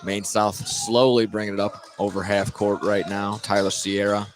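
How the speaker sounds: ordinary talk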